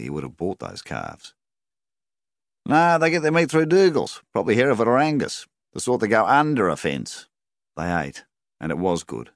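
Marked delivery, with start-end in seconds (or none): 2.7-7.3 high, loud livestock agent's chatter; agent Colin. A narrator's reading. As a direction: toss out the answer in a raised character voice. Agent Colin answering about the steaks.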